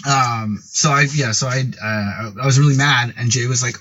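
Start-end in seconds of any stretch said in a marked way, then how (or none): none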